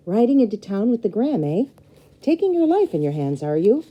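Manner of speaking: high, loud teasing uncle; Uncle Gideon teasing. Acted. Uncle teasing his niece about riding with the Graham, loud and high. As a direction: tease her as she sets off. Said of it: "said Uncle Gideon".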